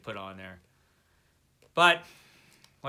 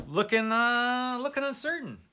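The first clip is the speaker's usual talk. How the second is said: mock uncertain; 10 semitones higher, 4 dB louder than usual. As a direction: stretch it out, sounding unsure and much higher. Doubting he'll get to the book.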